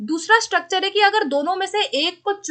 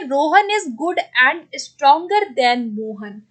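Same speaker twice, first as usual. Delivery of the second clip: slow dictation; much slower than usual. Shown as a demonstration. Teacher reads out the exercise sentence.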